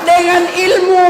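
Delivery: ilmu shouted out high and slow; stress on ilmu. Real, not acted. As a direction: hit the word ilmu hard. Preacher stressing key word. (tekanan keras pada kata ilmu)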